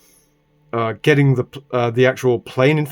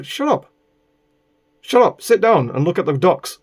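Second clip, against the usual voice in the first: higher, exasperated mock retort; fed-up mock telling-off; mock shut up; 5 semitones higher than usual. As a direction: snap back at her in a raised mock voice. Telling the woman off in his head.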